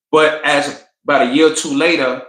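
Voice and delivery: ordinary delivery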